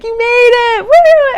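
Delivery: high, loud cheer of welcome. Retold or imitated. Crowd at breakfast welcoming her back, cheering loudly.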